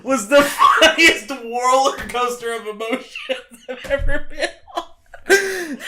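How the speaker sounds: crying with laughter while talking; laughing hard. Real, not acted. Co-host to host after his failed joke, laughing hard.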